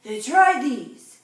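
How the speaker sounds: loud, slow doctor's order; loud specialist. A narrator's reading. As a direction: hand it over with a firm, slow command. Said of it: "said the specialist".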